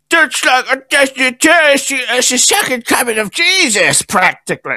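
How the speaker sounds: high, mocking fanboy voice; whiny superfan gushing; mocking hater voice. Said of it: "haters in the comments being like".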